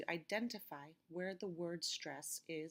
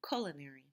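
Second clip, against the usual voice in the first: culinary with a louder first syllable; first-syllable stress; 4 semitones lower, 3 dB louder, faster than usual. Shown as a demonstration. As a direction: lean on the CUL of culinary, then drop away. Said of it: "The stress is on the first syllable".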